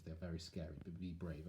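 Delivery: ordinary delivery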